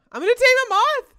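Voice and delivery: fast, loud, high-pitched glee; excited squeal. Real, not acted. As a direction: blurt it out in a thrilled shriek. Thrilled about taming a moth.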